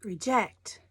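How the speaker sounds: reJECT, second syllable punched; stress on second syllable. Shown as a demonstration. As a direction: say Reject as ri-JECT. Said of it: "emphasis is on the second syllable".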